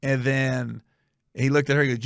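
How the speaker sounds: ordinary talk, nothing marked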